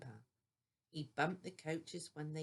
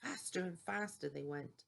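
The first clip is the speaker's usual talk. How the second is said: usual speaking voice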